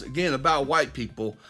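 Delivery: ordinary talk, nothing marked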